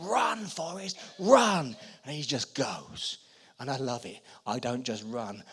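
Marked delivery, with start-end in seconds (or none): 0.0-1.8 calling out. Retold or imitated. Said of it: "here's Jenny saying".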